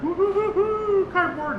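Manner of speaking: high, drawn-out whoop; mock celebration. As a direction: pretend to be thrilled, way up high. Jokey celebration, then "I'm kidding.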